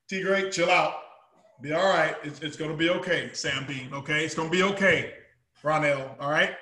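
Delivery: low, soft, calming voice; soothing reassurance. Retold or imitated. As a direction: settle down an anxious fan gently. Calming excited fans.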